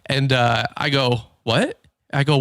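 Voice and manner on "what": retold taken-aback "what", pitched up; mock disbelief. Husband reacting to his wife's teasing, mock disbelief. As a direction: act out being caught off guard.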